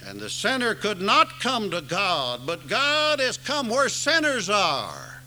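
rising, loud sermon climax; preaching at full force. Acted. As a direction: drive the gospel home from the pulpit. Preaching to the congregation.

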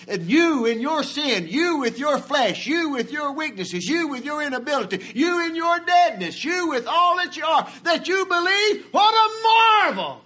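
rising from strong preaching to a full high shout; building preaching climax. Real, not acted. Preacher to congregation, building to a shouted marvel. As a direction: build it line by line to a ringing cry of wonder.

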